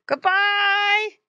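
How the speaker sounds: high, loud pig squeals; squealed farewell. A narrator's reading. Pig family to departing Squinty, squealing goodbye. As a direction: squeal it out like pigs in a pen. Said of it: "they all grunted and squealed".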